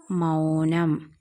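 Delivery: drawn-out, syllable by syllable, x0.4; slow blending. Shown as a demonstration. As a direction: stretch മൗനം slowly as മൗ-നം for learners. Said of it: "മൗ എന്ന് വായിക്കും".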